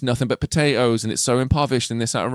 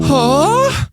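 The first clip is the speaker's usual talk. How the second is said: mock gasp; 4 dB louder than usual. Mock shock at a twist.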